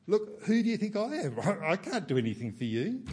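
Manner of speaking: high, loud flustered excuse with a laugh; flustered, nervous brush-off; mock incompetent dodge. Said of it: "He doesn't try and dodge the issue".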